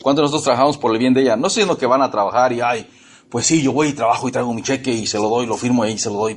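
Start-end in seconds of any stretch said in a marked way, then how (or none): 2.6-6.3 preacher mimicking a husband who just hands over his cheque; mock complacent husband. Husband shrugging off the work, lower mock voice.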